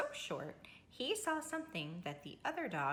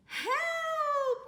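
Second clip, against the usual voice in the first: much higher yelp for help; frightened cry; 4 dB louder than usual. A narrator's reading. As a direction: squeal for help. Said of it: "cried the cats".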